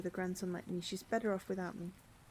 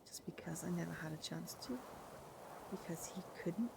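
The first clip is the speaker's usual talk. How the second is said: hushed whisper, barely voiced; 4 semitones lower, 8 dB quieter than usual. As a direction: let it fade into a whisper. Whispered confession in game.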